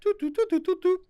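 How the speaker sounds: high sung doots imitating a phone ring; imitating the phone ringing, high and light; ringing phone sound. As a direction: chirp the ring tones up high. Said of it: "Do the rings for me".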